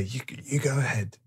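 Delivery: put-on coaxing an old man forward in a whisper; whispered urging. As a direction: whisper him on.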